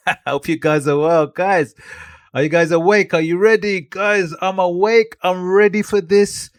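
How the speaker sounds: high, energetic call to the audience; hyped greeting. Acted. Creator asks viewers if they're ready, high hype voice.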